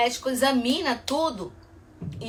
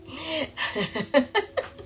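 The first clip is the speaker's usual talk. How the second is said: laughing; 5 semitones higher, 6 dB quieter than usual. Real, not acted. Jokes she has ADHD.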